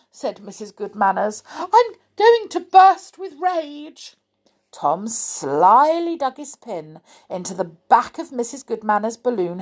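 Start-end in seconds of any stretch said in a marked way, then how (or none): 1.6-4.2 high, slow swell of fury; hammed-up rage, ready to pop; enraged. A narrator's reading. Missus Goodmanners about to burst at Amelia, enraged. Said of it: "She's getting angrier and angrier".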